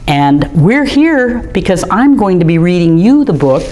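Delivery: ordinary delivery, nothing marked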